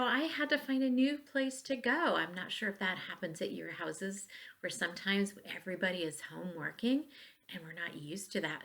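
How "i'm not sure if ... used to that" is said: quick near-whisper aside; hushed aside. Real, not acted. Teacher confiding to students, quick whispered aside.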